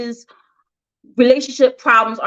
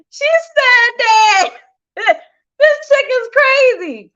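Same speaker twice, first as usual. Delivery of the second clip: laughing disbelief; 11 semitones higher, much slower than usual. Real, not acted. Laughing at pastor's quote.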